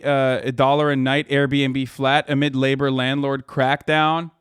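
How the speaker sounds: ordinary delivery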